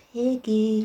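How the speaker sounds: eerie drawn-out whispered name; horrible whisper. Acted. The voice outside calling to Piggy, horrible whisper. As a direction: whisper it like a lurking horror. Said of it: "A voice whispered horribly outside".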